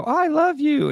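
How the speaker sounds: very high squeaky toy voice. Retold or imitated. A Furby toy coos at its owner, very high and squeaky. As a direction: chirp it like a tiny talking toy. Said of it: "and they'd go".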